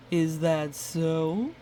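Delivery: slow, low doubtful wolf; skeptical wolf. Acted. Wolf doubting Goose's warning, slow and skeptical. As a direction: drawl out the disbelief.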